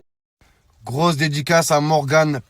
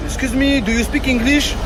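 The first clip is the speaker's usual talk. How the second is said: put-on English accent; 10 semitones higher, 5 dB louder than usual. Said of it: "elle me dit".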